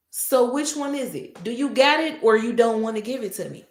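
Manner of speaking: ordinary talk, nothing marked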